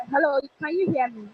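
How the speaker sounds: ordinary talk, nothing marked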